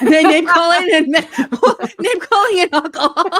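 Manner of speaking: loud, high laughing repeat. Real, not acted. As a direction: repeat it through giggles. Laughing at her description.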